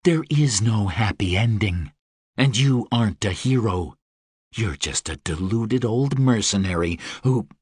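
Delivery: low, smooth and seductive; sultry Deva voice. Acted. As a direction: purr it like a dangerous winged queen. Deva character voice.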